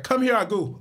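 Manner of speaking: high, loud put-on accent; movie's African accent. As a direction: mimic the film's off accent. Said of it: "he'd be like".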